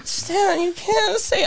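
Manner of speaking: very high, pleading objection; mock embarrassed protest. Godson objecting to godfather in the store, mock mortified.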